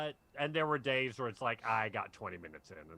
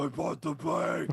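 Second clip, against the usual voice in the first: higher, louder put-on Batman line; 5 semitones higher, 8 dB louder than usual. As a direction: belt it out as Batman.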